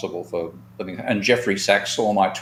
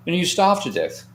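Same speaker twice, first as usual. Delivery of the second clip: pitched much higher, with grim emphasis; quoting Russian friend; 10 semitones higher, 3 dB louder than usual. Retold or imitated. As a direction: land his grim punchline up high. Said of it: "this is me pretty much quoting what he said".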